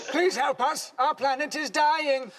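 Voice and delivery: high, slow plea from a dying world; mock alien distress call; pleading alien. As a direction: beg for rescue like a sci-fi alien. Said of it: "Are they saying".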